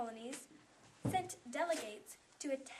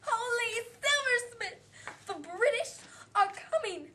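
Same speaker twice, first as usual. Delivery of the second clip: much higher, much louder frantic cry; panicked scream; 11 semitones higher, 13 dB louder, slower than usual. Acted. Potts panics as the British approach, screaming. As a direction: scream it like the sky is falling.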